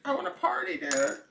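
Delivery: very high, loud party-hungry squeal; partying angels. Acted. Angels refusing to leave the chalkboard, very high squeal. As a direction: squeal it like a stubborn little party animal. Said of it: "they're like".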